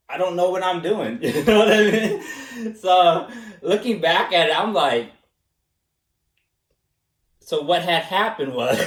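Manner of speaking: ordinary delivery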